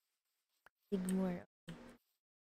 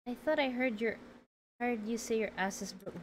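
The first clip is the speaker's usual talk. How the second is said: ordinary talk, nothing marked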